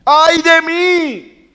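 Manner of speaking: slow, very high, loud drawn-out cry; anguished wail. Acted. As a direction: wail it out in despair before God. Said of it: "él está exclamando". (lamento desgarrado, lento, muy agudo y fuerte)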